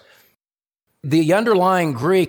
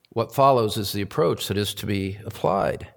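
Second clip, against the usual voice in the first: normal talking voice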